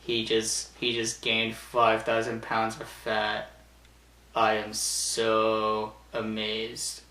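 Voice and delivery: low, quiet, slow deadpan; deadpan Raven. Retold or imitated. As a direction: drone it out, unimpressed and soft. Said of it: "goes like this".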